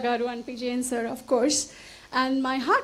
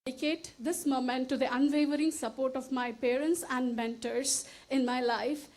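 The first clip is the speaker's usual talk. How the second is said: emotional; 9 dB quieter than usual. Real, not acted. Mother thanking her parents at the award, voice choking with emotion. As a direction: hold back tears as the words fade. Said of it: "Sorry, I'm getting emotional".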